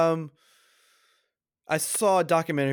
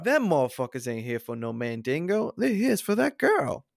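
put-on suspicious servant voice; emphatic character impression, climbing high at the end; Steven; 4 semitones higher than usual. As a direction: act out an insistent warning. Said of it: "He goes".

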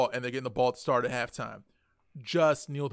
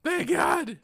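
very high-pitched cry of relief; retold relief; 14 semitones higher than usual. Fan retelling Liverpool's winning goal, crying out relieved. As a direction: wail it as if rescued at last.

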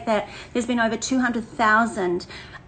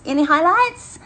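higher put-on voice; 6 semitones higher, 5 dB louder than usual. Shown as a demonstration. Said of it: "be stopping talking like this".

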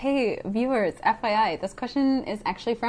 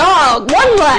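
loud, higher mock townsperson; dismissive townsfolk; 6 semitones higher, 11 dB louder, slower than usual. Townsfolk on the dead woman, loud mock dismissal. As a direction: shrug it off in a big loud voice. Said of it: "And they'd be like".

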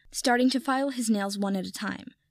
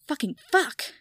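sudden startled swearing; shocked curse; 6 semitones higher than usual. Acted. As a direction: blurt it out in shock. Shocked discovery in book.

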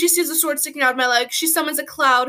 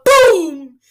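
loud shouted boom; explosion sound; 4 semitones higher, 9 dB louder than usual. Acted. Kid narrating his dream, shouting the mud bombs going off. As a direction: blast it out like an explosion.

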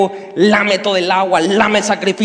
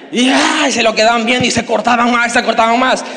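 ordinary talk, nothing marked

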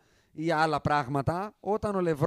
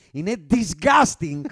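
DISGUSTING shouted, drawn out slowly; very loud; 8 dB louder, much slower than usual. Real, not acted. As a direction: belt out DISGUSTING with emphatic revulsion. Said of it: "Έπρεπε να το πω πολύ δυνατά". (πολύ δυνατά και αργά, με έμφαση στο DISGUSTING)

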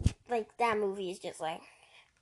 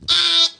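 loud mock shriek of a scared kid; imitated scream; 8 semitones higher, 18 dB louder than usual. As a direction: shriek it like the terrified little brother. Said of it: "he's like".

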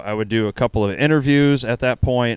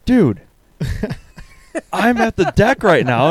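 high-pitched, louder retold excitement; mock-excited text; 8 semitones higher, 3 dB louder than usual. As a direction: read out a friend's text, hyped up.

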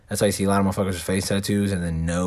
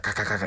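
rapid "ka" clicks of a laser; imitated laser zaps; faster than usual. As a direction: mimic the laser firing in bursts. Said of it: "and they start".

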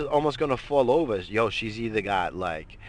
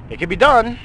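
suddenly loud and high; loud exclamation; 12 semitones higher, 10 dB louder than usual. Real, not acted. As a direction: burst out with conviction. Sudden emphatic outburst.

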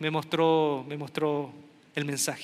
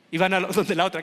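ordinary talk, nothing marked

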